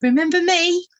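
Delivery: high, loud and bright-eyed; eager greeting. Acted. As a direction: burst in on an old friend. Swift greets the vole eagerly.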